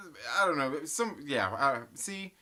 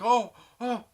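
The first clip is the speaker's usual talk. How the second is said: high, louder gasping mock concern; hamming up shocked onlookers; mock shocked bystanders; 8 semitones higher, 8 dB louder than usual. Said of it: "a couple people like".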